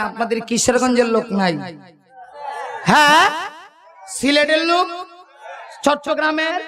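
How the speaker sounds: usual speaking voice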